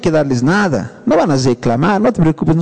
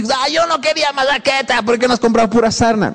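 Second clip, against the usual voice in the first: whiny complaint; 6 semitones higher than usual. Retold or imitated. Complaining spouse quoted at table.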